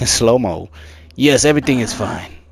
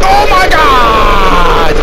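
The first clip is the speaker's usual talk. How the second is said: long, high, loud shriek; terrified scream; 12 semitones higher, 11 dB louder, much slower than usual. Real, not acted. Player hit by a jump scare, terrified scream. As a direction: scream it out, dragging the words.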